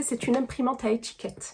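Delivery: usual speaking voice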